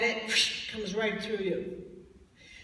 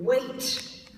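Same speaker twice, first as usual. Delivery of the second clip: weight lifted and leaned on, a bit louder; single operative word weight, pitched up and pushed; stress on weight; 4 dB louder than usual. Shown as a demonstration. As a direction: land hard on the word weight as the key word. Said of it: "here's the operative word".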